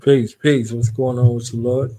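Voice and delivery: normal talking voice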